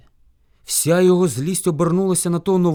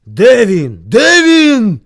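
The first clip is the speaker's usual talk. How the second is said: very loud repeated demand, rising higher; shouting bear; 6 semitones higher, 14 dB louder, much slower than usual. A narrator's reading. Bear searches for his rival, furious shout. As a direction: yell louder while hunting a foe. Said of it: "кричав ведмідь".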